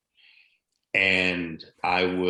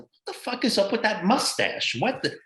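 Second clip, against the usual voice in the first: mock outrage; 12 semitones higher, 3 dB louder than usual. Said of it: "everybody in L A is like".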